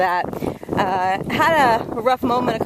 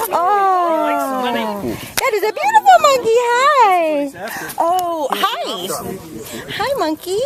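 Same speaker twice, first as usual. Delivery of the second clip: high, sing-song pet voice; cooing at a monkey; 8 semitones higher, much slower than usual. Real, not acted. Vlogger greets a cute monkey, high cooing pet voice.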